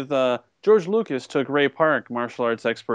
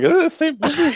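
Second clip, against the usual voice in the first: confused mock question; 4 dB louder than usual.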